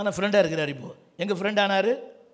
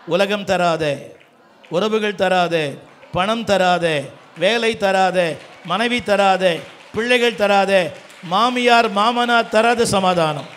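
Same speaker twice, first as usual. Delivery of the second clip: loud chant-like list, rising to a drawn-out climax; preaching at full force; 6 dB louder, much slower than usual. Real, not acted. Preacher listing who cannot give peace, building loud proclamation. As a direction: build each item louder, then stretch the last.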